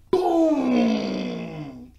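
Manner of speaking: loud, high cry of boom; excited boom. Real, not acted. Reacting to the punch on screen, loud excited cry. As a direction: shout the boom like the punch lands.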